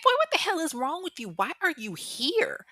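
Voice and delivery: high-pitched, incredulous acted demand; indignant confrontation. Woman confronts her ex in the garage, indignant. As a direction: act out being outraged at an intruder.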